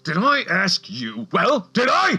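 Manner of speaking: loud, rising furious shout; slow then yelling, enraged; angry outburst. Acted. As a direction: snap at the boss in sudden rage. Assistant snaps in rage.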